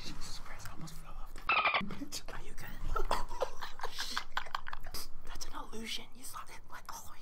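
hushed ASMR whisper. Real, not acted. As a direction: whisper close to the mic. ASMR segment, whispering into mic.

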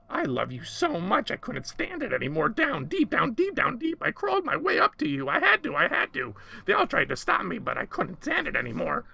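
very high, swooping creature voice; lovesick little creature, frantic. A narrator's reading. Creature tells madam of its long crawl up, frantic and high. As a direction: pour it out in high, insistent repeats.

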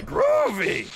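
drawn-out cartoon catchphrase; Earthworm Jim impression. Streamer relishing a favourite voice, hammed-up cartoon catchphrase. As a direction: stretch it out like a cartoon hero. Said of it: "so much fun to just do the voice".

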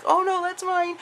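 high quick protest; retold protest. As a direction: squeak out a playful protest. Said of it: "I was like".

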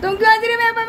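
playful complaint at the laughing family; teasing reproach; mock indignant. Real, not acted.

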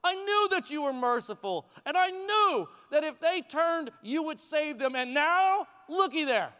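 acted indignant complaint, pitch riding high; upset. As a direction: protest to God like an aggrieved prophet. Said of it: "he is upset".

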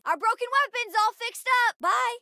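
high-pitched, gleeful report; excited callout. Acted. Child reporting a fixed weapon to the crew, excited. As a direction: announce the repair excitedly.

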